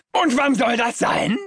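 very high-pitched enraged shouting; furious bellow. A narrator's reading. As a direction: roar it after someone storming out. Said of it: "brüllt ihm mein Vater hinterher".

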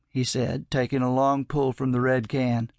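usual speaking voice

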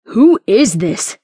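loud, slow demand. Acted. Royce looks between West and narrator, loud demand. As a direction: demand an answer forcefully. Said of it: "Royce demanded".

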